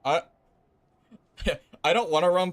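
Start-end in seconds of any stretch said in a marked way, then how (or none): none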